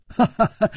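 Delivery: high, short staccato laughter; brief unbothered ha-ha-ha; amused laugh. A narrator's reading. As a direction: laugh off the threat lightly. Hero laughs off her challenge.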